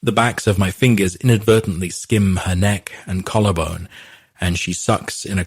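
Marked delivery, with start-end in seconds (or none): none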